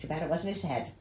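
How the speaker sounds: usual speaking voice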